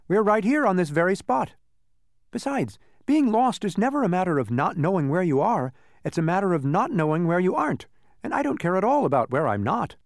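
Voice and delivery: high-pitched, lively character voice; Alec, confident explainer. Acted. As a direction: explain it high and sure of yourself. Boy character explains from branch.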